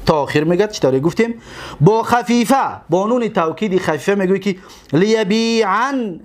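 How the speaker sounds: usual speaking voice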